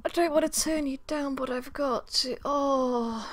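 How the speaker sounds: high, drawn-out reluctance; reluctant refusal. Acted. Turning down a friend's invitation, reluctantly.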